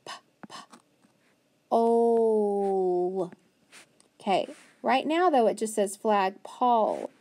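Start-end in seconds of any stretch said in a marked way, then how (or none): 0.0-3.3 "p" whispered then "ole" drawn out; sounding out. Shown as a demonstration. Teacher sounding out pole for the class. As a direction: puff the p, then stretch "ole". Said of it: "and then".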